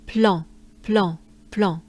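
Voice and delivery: plan with a nasal French an; nasal plan drill. Shown as a demonstration. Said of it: "goes into plan".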